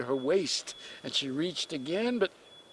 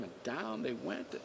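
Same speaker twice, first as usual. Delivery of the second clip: plain reading voice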